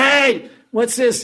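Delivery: high, loud, drawn-out "hey"; imitating a startled victim's shout; indignant yell. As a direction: yell out at being robbed. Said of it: "while he was yelling".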